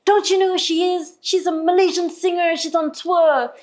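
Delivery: high, insistent retelling of a friend's pitch; imitating hyping friend. Friend vouching for the singer to the moderator, excited. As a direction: talk her up, high-pitched and eager.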